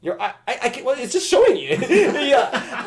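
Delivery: high, laughing flustered stammer; squeaky embarrassed protest breaking into laughter. Acted. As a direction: stammer helplessly in a high, giggly voice. Acting out flustered by cleavage.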